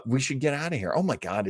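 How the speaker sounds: ordinary talk, nothing marked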